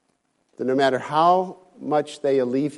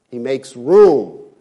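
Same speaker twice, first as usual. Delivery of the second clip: ordinary delivery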